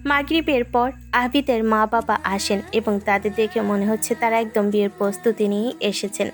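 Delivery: ordinary delivery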